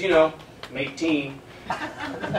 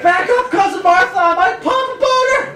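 high, loud panicked yelp; panicked plea; 11 semitones higher, 4 dB louder than usual. Retold or imitated. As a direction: beg them back in comic panic. Panicked plea to hugging cousin.

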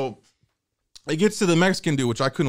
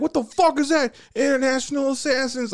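inmate bully; 12 semitones higher than usual. Retold or imitated. Inmate mocking kid.

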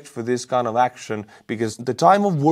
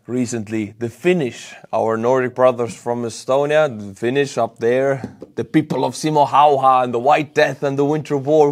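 ordinary talk

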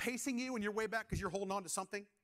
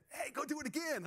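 high-pitched mock buddy voice; egging-on buddy; 7 semitones higher than usual. Buddy eggs his coworker on to turn up store music, high mock urging. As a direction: imitate a pal urging mischief in a raised pitch.

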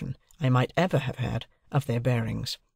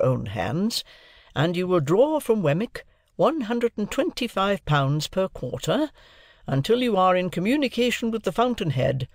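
high, emphatic, formal declaration; authoritative lawyer; 6 semitones higher than usual. A narrator's reading. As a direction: set out the terms clause by clause. Lawyer figure sets out the terms.

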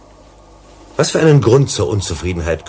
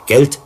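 Geld hit high and loud, then a drop; stressed Geld; 8 semitones higher, 5 dB louder than usual. Acted. Investigator lecturing the director on strikers' demands, punching Geld. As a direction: throw out the word Geld with force.

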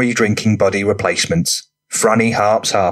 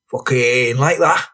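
rough lad voice; 3 semitones higher, slower than usual. A narrator's reading. Franny character voice.

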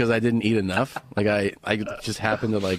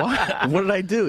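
higher-pitched protest of innocence; baffled protest; 8 semitones higher than usual. Retold or imitated. Comic retelling of being scolded by Joey, baffled protest. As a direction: act out a baffled, innocent comeback. Defends himself against retold scolding.

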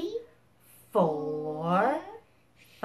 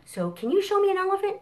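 excited; 13 semitones higher, 5 dB louder, much faster than usual. Real, not acted. Storytime leader exciting kids.

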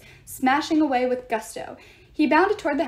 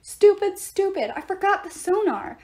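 high, loud self-reproach; self-scolding; 7 semitones higher, 4 dB louder than usual. A narrator's reading. Leo scolding himself about the sonar, frustrated. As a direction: berate yourself in frustration. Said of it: "Leo scolded himself".

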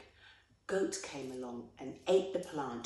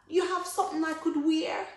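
put-on high, loud child's voice asking; begging boy; 11 semitones higher, 7 dB louder than usual. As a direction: ask pleadingly as a ragged child. Ragged boy begs for clothing.

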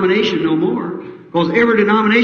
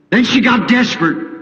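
ordinary delivery

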